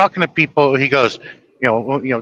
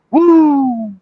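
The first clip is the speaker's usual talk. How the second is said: long high "woo"; high whoop; 12 semitones higher, 4 dB louder than usual. Acted. Man marvelling at the crowds that showed up, high whoop. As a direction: let out a drawn-out high woo. Reacts to huge town hall turnout.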